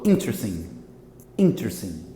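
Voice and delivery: front-stressed, shortened "interesting"; vanishing syllable. Shown as a demonstration. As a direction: swallow the "res" in "interesting". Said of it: "Esse res aqui quase some".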